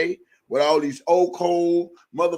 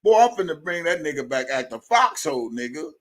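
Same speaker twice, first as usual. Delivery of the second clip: usual speaking voice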